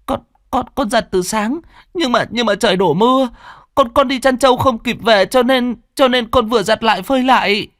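put-on high, stuttering child's plea; frightened boy explaining the wet clothes, high and stammering; stammering fear. A narrator's reading. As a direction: stumble over the words, terrified. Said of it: "sợ sệt".

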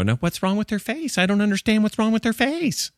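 high-pitched, baffled in-law voice; appalled mother-in-law. Acted. Mother-in-law seeing the zombie book cover, high-pitched dismay. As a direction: fret in a high put-on voice.

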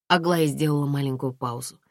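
ordinary delivery, nothing marked

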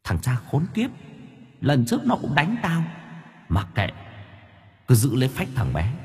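a thin, spiteful ghost murmur; second demon plots revenge, soft and high. A narrator's reading. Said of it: "Một giọng nói khác lại vang lên".

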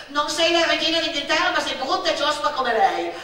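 taunting retort. Acted. Insulting retort in quarrel.

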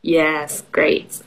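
ordinary talk, nothing marked

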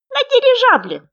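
high, loud, squeaky little frog; small creature's high, loud voice. A narrator's reading. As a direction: pipe up eagerly as a tiny frog. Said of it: "сказал лягушонок".

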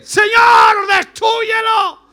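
high, loud hammed-up cry for revenge; mock furious prayer shouted; mock vengeful prayer. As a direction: beg God to destroy them. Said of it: "Qué hubiera dicho usted".

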